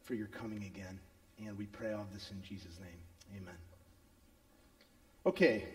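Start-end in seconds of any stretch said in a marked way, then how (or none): 0.0-3.5 quiet closing prayer. Real, not acted. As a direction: pray softly.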